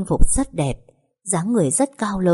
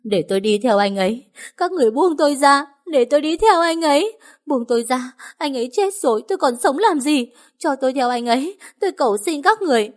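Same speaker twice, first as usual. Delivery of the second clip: desperate crying; 9 semitones higher than usual. A narrator's reading. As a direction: beg to follow him, sobbing hard. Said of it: "Tiếng khóc đã đục".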